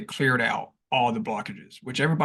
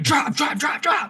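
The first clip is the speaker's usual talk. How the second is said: high frantic shout; urgent shouting; 4 dB louder than usual. Retold or imitated. Telling friend to drop the gear, frantic high shouting. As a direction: yell it over and over in panic. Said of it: "And I'm like".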